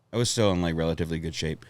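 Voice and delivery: ordinary talk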